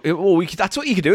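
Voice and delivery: ordinary talk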